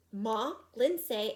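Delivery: ordinary delivery, nothing marked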